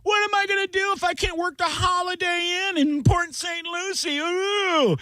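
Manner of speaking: very high, hammered-up mock wailing; mock despair. Comic mocking the old man's ban, mock despairing wail. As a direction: wail it high in fake panic.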